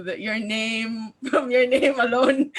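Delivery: usual speaking voice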